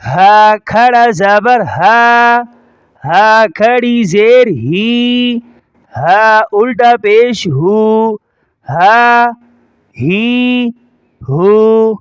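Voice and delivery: slow drill, "ہا، ہی، ہو" held long and level; ha from the chest. Shown as a demonstration. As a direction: breathe out the h of "ہا" from the chest and stretch it. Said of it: "اور یہ سینے سے". ("ہا" سینے سے، کھینچ کر)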